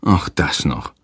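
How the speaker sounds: low, weary groan. A narrator's reading. Father hears of the dog's accident, groaning low. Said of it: "stöhnt Hans".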